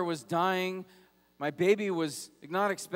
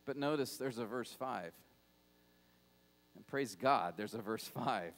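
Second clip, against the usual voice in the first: much lower and softer, reverent; quiet awe at verse five; hushed; 8 semitones lower, 8 dB quieter than usual. Real, not acted. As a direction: lean in and murmur the good news. Turning point of the sermon.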